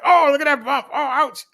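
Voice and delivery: high, whiny mock complaint about the bumps; hammed-up high whimper over a bump; mock complaining rider. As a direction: whine like a sore rider feeling every bump. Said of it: "You heard me complaining on the old bike".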